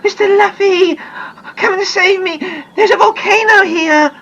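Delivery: high, loud crying plea; frightened girl crying for rescue; screaming in relief. Acted. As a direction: sob it out, desperate to be saved. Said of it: "Anne screamed to him in relief".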